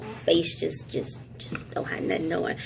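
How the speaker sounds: soft breathy whisper close to the camera. Real, not acted. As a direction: confide it in a hush. Close-up, no makeup, hushed aside.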